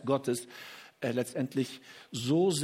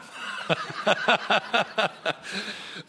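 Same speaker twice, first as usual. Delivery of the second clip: hearty laugh; 5 semitones higher than usual. Real, not acted. Preacher amused by his son's advice, hearty laughing.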